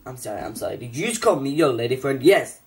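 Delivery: ordinary delivery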